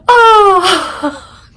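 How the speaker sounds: high cackling laugh breaking into "Oh"; real burst of laughter. Real, not acted. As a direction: laugh helplessly at the memory. Laughs at own story.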